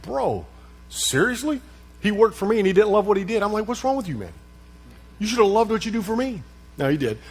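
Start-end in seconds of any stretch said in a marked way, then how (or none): 0.0-1.6 higher, playful disbelief; mock disbelief. 3.7-6.4 higher mock scolding voice; joking scold. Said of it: "it was a joking thing".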